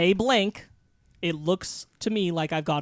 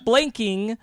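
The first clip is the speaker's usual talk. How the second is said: excited; 5 semitones higher, 6 dB louder than usual. Acted. Building SpongeBob quote.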